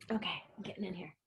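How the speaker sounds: quick unvoiced whisper. Real, not acted. Squeezes into the shot with a quick whisper. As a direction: slip in with a hushed aside.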